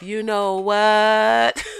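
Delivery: WHAT drawn out very long; dragged-out A. Shown as a demonstration. Asking Autumn how she says it before going off, dragged out. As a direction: stretch out the vowel in "what", very slowly. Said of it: "do you drag out that A".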